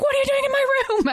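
put-on very high, fast squawk of an outraged kid; mock kid yell. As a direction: imitate a child yelling at an intruder. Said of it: "the other kid was like".

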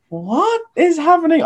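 high, loud and slow, utterly baffled; stunned, as if you can't believe your ears; baffled disbelief. Retold or imitated. Baffled at a shouting audience member.

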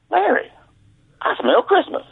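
very high put-on boss voice; suspicious boss. Boss confronting caddy over his breath, high mock suspicion.

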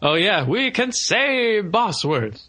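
mole kid imitation. Podcaster quoting the film's mole kid, mock revival preacher. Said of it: "the mole kid is trying to".

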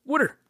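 Water said as wooder, the Philly way; Water in thick Philadelphia accent. Shown as a demonstration. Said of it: "we can't even say water".

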